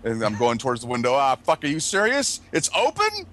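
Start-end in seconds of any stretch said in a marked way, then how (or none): 1.1-3.2 rising high-pitched disbelief; mock exasperation. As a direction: protest in comic dismay, climbing very high. Acts out floating out the window.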